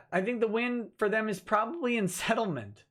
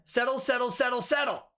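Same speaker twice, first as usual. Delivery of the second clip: higher, insistent chant of settle; mock the movie line; Liar Liar quote; 6 semitones higher than usual. Host quoting Liar Liar to a viewer, higher and insistent. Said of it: "Let me quote Liar Liar".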